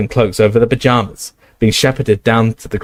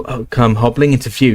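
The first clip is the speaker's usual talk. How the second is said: plain reading voice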